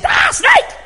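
very high, loud shriek of alarm; retold scream. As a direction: scream the warning in mock terror. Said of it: "I must have screamed so loud".

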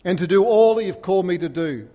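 loud, high preacher's cry; fervent preaching. Acted. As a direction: preach it out with full force. Preacher's opening plea.

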